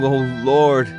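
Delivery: ordinary talk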